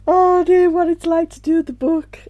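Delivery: high and loud, tearful laugh-cry; laughing through tears. Real, not acted. Telling viewers about the book while crying and laughing, high tearful voice.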